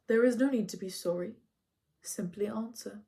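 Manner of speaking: a hint of a patient teacher; gentle command. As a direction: ease him into answering. Chiron gently presses the boy.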